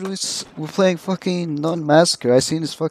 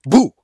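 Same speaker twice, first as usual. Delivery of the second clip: startling boo; 6 semitones higher, 8 dB louder than usual. Pretend. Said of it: "Did I scare you".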